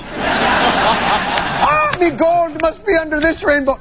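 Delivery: high hammed-up Irish leprechaun voice. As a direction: ham up a bad Irish leprechaun. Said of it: "that was the worst leprechaun impression".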